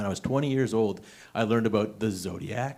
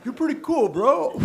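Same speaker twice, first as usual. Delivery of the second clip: much higher dude voice; mock passenger; 13 semitones higher than usual. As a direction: play a laid-back passenger. Passenger quoted in a voice.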